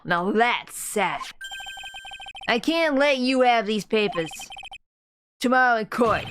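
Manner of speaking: Brooklyn wiseguy laying out a threat; Brooklyn mobster accent. Acted. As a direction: play a Brooklyn-accented mob heavy. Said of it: "Oh, Brooklyn like Meowth".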